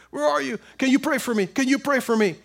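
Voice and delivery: high-pitched, needy begging; mock pleading. Struggling believer asking others for prayer, mock pleading.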